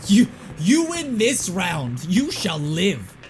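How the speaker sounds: slow, lofty mock-villain decree; mock grandiose. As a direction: spare them like a hammy ruler. Mock grand speech to enemy.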